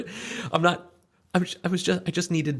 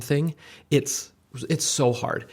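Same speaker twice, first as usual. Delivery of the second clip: ordinary talk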